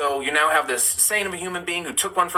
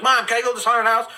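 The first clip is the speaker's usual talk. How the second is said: put-on eager kid asking; 7 semitones higher, 4 dB louder than usual. Teen self asking mom.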